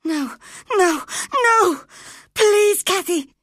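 put-on terrified boy screaming and clinging to the girl; wild scream. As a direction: scream it in panic, begging. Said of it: "screamed Linton wildly".

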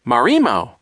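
put-on baby Sunny's loud little outburst; baby babble. A narrator's reading. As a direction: blurt it out like a baby. Baby's outburst voiced in story.

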